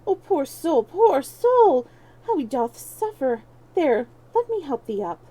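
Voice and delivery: lightly voiced pitying gentleman; pitying stranger. A narrator's reading. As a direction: fuss over the sick boy with concern. Kind stranger pities fallen beggar.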